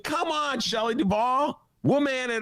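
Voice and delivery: high, slow teasing voice; lightly voiced heckling at the screen; mock pep talk. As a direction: urge her on in a high mocking tone. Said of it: "I'm like".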